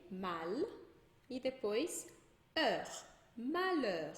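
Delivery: "mal", then "heure", then joined as "malheur"; sílabas separadas. Shown as a demonstration. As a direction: break "malheur" into parts, holding the L of "mal". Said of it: "eu pronuncio o L". ("malheur" em partes: "mal", depois "heure", H mudo)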